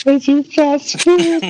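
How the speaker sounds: high and slow through laughter; laughing. Real, not acted. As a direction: repeat the pun while cracking up. Laughing echo of a pun.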